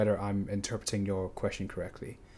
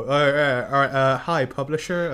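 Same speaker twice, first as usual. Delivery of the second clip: flustered author; 4 semitones higher, 6 dB louder than usual. Pretend. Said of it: "go like".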